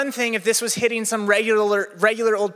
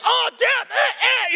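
mock panic; 14 semitones higher, slower than usual. Panicked reply to shouting coach.

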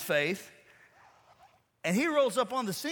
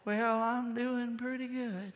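slow, higher put-on feeble reply; attention-seeking grandmother; 4 semitones higher, slower than usual. Grandmother answering her friend's call, milking it for attention. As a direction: drag it out, playing for sympathy. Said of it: "'cause you want attention".

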